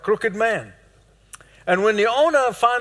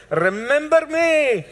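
much higher and slower "Remember me?" in a Middle Eastern accent; a preacher showing the Middle Eastern way to say "Remember me?"; 8 semitones higher, slower than usual. Shown as a demonstration. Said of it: "That's how they say it in the Middle East".

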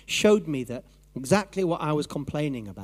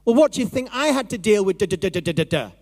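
lightly voiced sharp retort, a touch louder; mock voice of Jesus snapping; 4 semitones higher, 4 dB louder, faster than usual. Jesus snapping back at the complaining preacher, mild mock retort. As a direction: snap back as Jesus in the mind. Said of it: "I hear this voice".

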